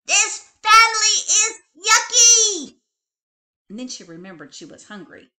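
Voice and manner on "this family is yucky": high, very loud tantrum scream; little bunny screaming at her family; screaming child. A narrator's reading. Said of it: "Betty Bunny screamed".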